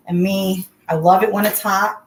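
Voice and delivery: ordinary talk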